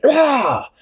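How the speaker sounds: high-pitched acted cry of pain; pained yelp. A narrator's reading. Captain Barnacle stung by branches, acted pained yelp.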